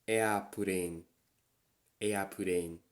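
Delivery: airplane as eapurein; katakana English. Shown as a demonstration. Sharing a favorite katakana word. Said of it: "my favorite Japanese word".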